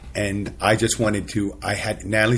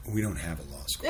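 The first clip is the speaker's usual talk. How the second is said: dean's reply; 2 semitones lower, 10 dB quieter than usual. Retold or imitated. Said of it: "dean goes".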